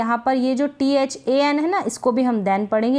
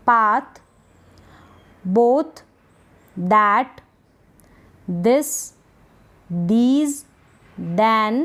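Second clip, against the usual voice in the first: one word at a time, rising tune, long pauses; word-list read-aloud; much slower than usual. Shown as a demonstration. Teacher rereads the spellings for practice, word by word. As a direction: isolate each word clearly, lifting the end. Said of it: "फिर से इन spellings को read".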